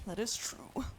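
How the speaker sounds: soft whispered agreement; quiet ASMR aside. Real, not acted. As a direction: whisper it to the mic.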